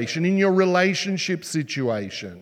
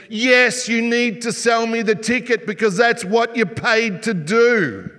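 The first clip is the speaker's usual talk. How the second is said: higher and louder, insisting hard; firm retort; 6 semitones higher, 4 dB louder than usual. Retold or imitated. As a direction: push back like a fed-up customer. Retorts to the lazy clerk.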